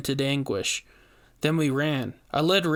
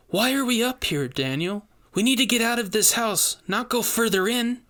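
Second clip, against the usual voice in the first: higher, urgent and worried; anxious Rainey; 8 semitones higher than usual. A narrator's reading. Rainey urging Daniel to flee the house, worried and high. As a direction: plead to get out now.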